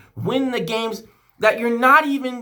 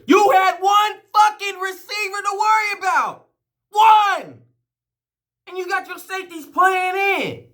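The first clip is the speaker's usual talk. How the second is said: higher, louder angry yelling at the coach; furious shout; 10 semitones higher, 4 dB louder than usual. Real, not acted. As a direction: let it burst out, hammering One. Furious fan ranting at coach.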